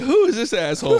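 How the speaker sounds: high, loud laughing mock outrage; annoyed teammate. Huberdeau reacting to the fallen player, high, loud and laughing. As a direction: spit it out through a laugh. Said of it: "Jonathan Huberdeau was like".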